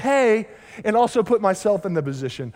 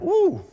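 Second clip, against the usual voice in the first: high playful woo; mock whoop; 11 semitones higher than usual. Pastor imitates wife's Sunday whoop, high and playful. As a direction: whoop like an admiring wife. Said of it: "Every Sunday morning I go".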